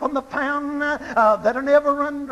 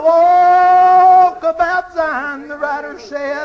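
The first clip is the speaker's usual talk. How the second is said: fervent cry; 3 semitones higher, 7 dB louder than usual. Real, not acted. Preacher climax cry.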